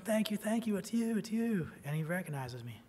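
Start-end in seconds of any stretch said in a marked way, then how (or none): 0.0-1.7 high, loud burst of grateful recognition; happy, excited thanks. Retold or imitated. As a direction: call it out, overjoyed to spot him. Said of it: "He's, he's happy".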